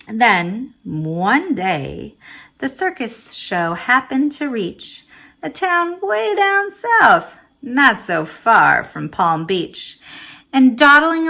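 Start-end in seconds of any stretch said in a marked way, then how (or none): none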